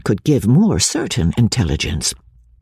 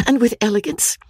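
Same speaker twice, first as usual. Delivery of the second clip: eager delight; 11 semitones higher than usual. A narrator's reading. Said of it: "cried Marianne, with sparkling eyes".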